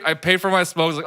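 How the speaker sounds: ordinary talk, nothing marked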